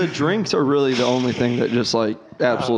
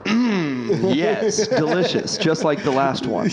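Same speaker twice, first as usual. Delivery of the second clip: higher, savoring voice, laughing at the end; mock-posh connoisseur; 4 semitones higher, slower than usual. Imitating the grocery-store weirdo savoring a drink, hammed-up posh. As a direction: relish it like a snobbish gourmet. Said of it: "And he talks like that".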